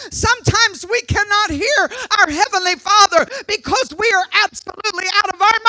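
high-pitched, urgent preaching narration; impassioned preaching. Acted. Preaches the lesson.

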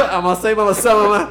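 loud, high mock glossolalia; laughing parody of Pentecostal tongues; speaking in tongues. Friends joke about Pentecostals, mock speaking in tongues. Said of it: "Porque ellos hablan en lengua".

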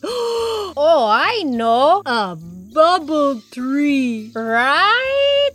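high, stretched-out triumphant guess. A narrator's reading. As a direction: answer as if you've surely won. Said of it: "Porco said triumphantly".

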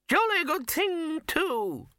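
put-on high, curt old man snap; snapping. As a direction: bark it out as the old wizard. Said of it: "snapped Poons".

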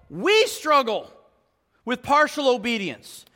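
rising sermon voice, pressing the point; impassioned preacher pressing the congregation; preaching. Acted. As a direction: preach it with building force. Preaching at full force.

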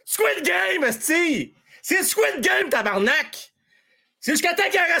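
high, triumphant exclamation; excited shout. Real, not acted. Remembering the show's name for his co-hosts, excited shout. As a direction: blurt out the name once it comes back.

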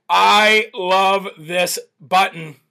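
said slowly, relishing it; drawn out with delight; slow savoring. Shown as a demonstration.

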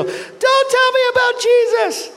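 very high, slow mock shout; imitating a waitress shouting. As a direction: yell it back at him.

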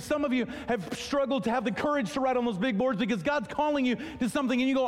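ordinary talk

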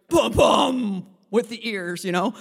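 loud booming elephant stomps; elephant warning. Retold or imitated. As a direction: boom it out like a huge elephant. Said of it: "big aunties at the back going".

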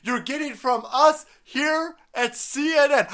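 high-pitched mock news anchor; hammed-up cable news host; Cuomo. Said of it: "I think that was Cuomo".

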